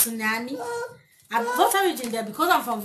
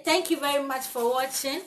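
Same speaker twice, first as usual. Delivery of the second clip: ordinary talk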